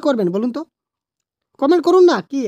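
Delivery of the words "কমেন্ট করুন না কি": higher, louder push to comment; urging call. Real, not acted. Teacher urging video viewers to comment, loud and pleading.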